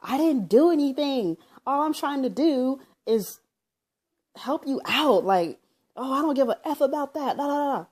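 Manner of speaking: high, loud re-enactment of an argument; retold yelling. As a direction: act out yelling back in exasperation. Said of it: "we were still kinda yelling back and forth".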